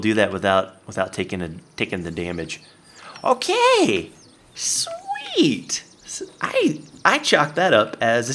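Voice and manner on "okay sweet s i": very high whispered sweet; squeaky delight. Pretend. Player pleased his trap worked, squeaky high delight. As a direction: squeak out a tiny victory.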